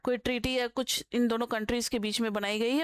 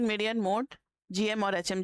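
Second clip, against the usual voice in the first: usual speaking voice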